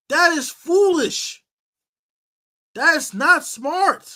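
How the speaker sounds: each word slow and heavy; slow scolding. Real, not acted. Host scolding a player, slow and deliberate.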